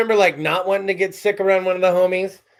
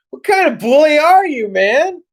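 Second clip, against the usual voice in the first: indignant retort; 8 semitones higher, 5 dB louder than usual. Retold or imitated. Retorting to the bully.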